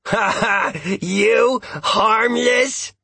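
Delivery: high, drawn-out incredulous laugh and question; mocking laughter. A narrator's reading. Dog laughs at his human being called harmless, mocking. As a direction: scoff with a laugh, stretching the words.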